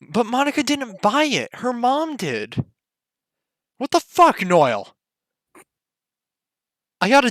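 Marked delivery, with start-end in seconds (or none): 0.0-2.6 high-pitched, protesting schoolgirl objection; indignant protest. Acted. Player acting protest in scene. 3.8-5.0 exasperated outburst. Real, not acted. Scolds friend over accidental ban.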